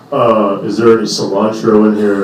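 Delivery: slow, put-on customer voice; lightly voiced diner; mock slow customer. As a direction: drawl it like a clueless diner. Guy asks about cilantro.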